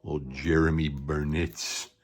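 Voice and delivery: ordinary talk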